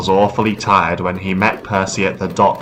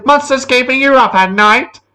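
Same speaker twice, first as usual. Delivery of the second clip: high, loud call from a passing engine; James calling out; 5 dB louder than usual. A narrator's reading. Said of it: "laughing loudly".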